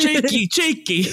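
put-on slow, high English accent with laughter; squeaky, giggly English-accent parody; mock English accent. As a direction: tease in a caricature English accent. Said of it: "Sorry for the English audience".

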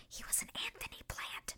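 hushed, whispered reveal; secretive whisper. Real, not acted. Host revealing the suitor was planted, in a whisper.

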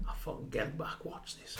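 hushed, barely voiced mock plotting; conspiratorial aside. Teammate about to hide for a prank, hushed conspiratorial aside. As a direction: mimic a prankster muttering very softly.

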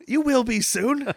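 high, slow character voice; put-on menacing sweetness. Woman choking the henchman, high mock threat. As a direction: slowly promise it in a high voice.

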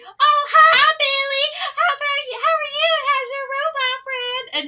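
put-on very high, loud girly squeal of hello; gushing girls' greeting. Boy acts out girls greeting him, gushing. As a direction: imitate excited girls greeting their favorite boy.

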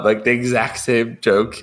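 ordinary delivery

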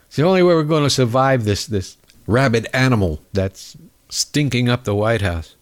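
ordinary delivery

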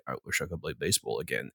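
hushed secret wish. Retold or imitated. Secret wish voiced in retelling.

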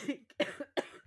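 rough hacking cough fit; chesty cough. Real, not acted. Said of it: "sorry, coughing fit".

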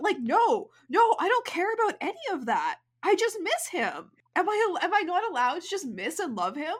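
high, loud, exasperated protest; indignant and fed up. Real, not acted. Defending missing him, exasperated.

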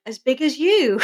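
a teasing mock delivery; lightly voiced teacher; quoting Miss French. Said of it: "she's like".

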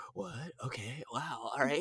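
hushed, near-whispered amazement; stunned disbelief. Retold or imitated. Actor learning character is final boss, stunned hush. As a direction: whisper it as the news sinks in. Acts out stunned reaction to news.

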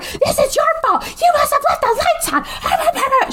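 high, fast mock shriek at her husband; wife blaming husband for the dead car, shrieking high; retold scream. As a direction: screech the blame at him, high and quick. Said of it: "they start screaming at each other".

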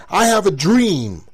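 soaring high booming sermon shout; Martin Luther King preaching. Retold or imitated. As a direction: preach it like a civil rights sermon, building. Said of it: "He said".

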